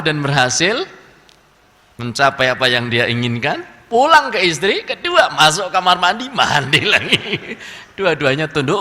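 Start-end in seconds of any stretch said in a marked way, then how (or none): none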